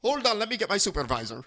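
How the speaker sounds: mock Indian accent calling the supervisor; imitated Indian accent. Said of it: "duplicate his voice and accent".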